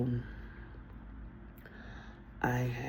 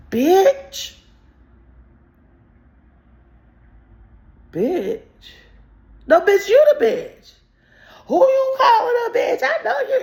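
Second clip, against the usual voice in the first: high, loud mock yelling; hammed-up screaming insults; retold shouting match; 9 dB louder, slower than usual. Hurling insults back at the girl, shouting.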